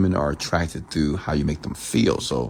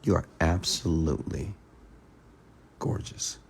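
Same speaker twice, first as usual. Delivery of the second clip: softly whispered compliment; 8 dB quieter than usual. Retold or imitated. Man telling a woman she is gorgeous, intimate whisper. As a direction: lean in and breathe the last word. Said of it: "can I share something with you?".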